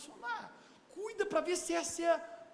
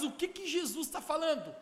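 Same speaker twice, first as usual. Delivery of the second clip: ordinary talk, nothing marked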